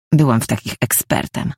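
ordinary delivery

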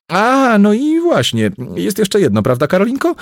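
high, loud eager interjection; excited burst. A narrator's reading. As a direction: jump in as if just remembered.

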